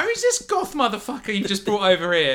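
high, sneering put-down; mock the newcomer in a high voice. GM voices rude NPC.

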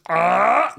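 loud, high monster "Ah!" roar; the beast opening its jaws with a cry. Acted. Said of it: "And goes".

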